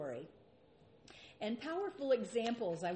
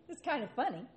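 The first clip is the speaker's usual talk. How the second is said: amused laugh; 5 semitones higher, much faster than usual. Real, not acted. Laughing at the disciples' retort.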